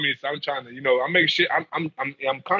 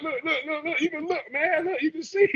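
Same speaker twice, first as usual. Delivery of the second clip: high pleading; 4 dB quieter than usual. Retold or imitated. Showing the guard the phone.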